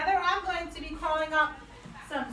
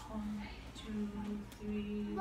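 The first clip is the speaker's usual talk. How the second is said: quiet test counting; 6 semitones lower, 11 dB quieter than usual. Shown as a demonstration. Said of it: "you tell me if you think I'm doing a good job".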